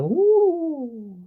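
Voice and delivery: high loud woo; excited whoop. Real, not acted. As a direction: cheer out loud. Reacting to lights.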